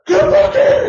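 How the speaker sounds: high-pitched frantic shout; hammed-up screaming parent, very high and loud; mock screaming. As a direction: yell it like a panicked parent calling a runaway. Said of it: "And I'm screaming, none of that".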